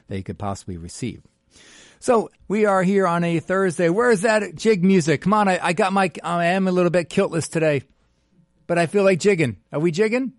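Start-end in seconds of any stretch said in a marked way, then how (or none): 2.0-10.3 high, loud and bouncy; excited. Real, not acted. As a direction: pump up the room like a party host. Host hyping up jig music.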